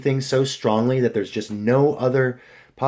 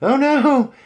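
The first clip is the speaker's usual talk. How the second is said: worried owner; 13 semitones higher, 4 dB louder, slower than usual. Retold or imitated. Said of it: "and I'm like".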